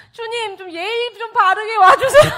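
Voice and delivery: loud, high plea breaking into laughter; comic protest. Retold or imitated. Helper talking back to the Lord, loud laughing protest. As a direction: cry out in mock exasperation, half laughing.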